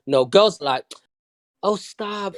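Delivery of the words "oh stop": softer, drawn-out mock flirting. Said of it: "That's how they flirt".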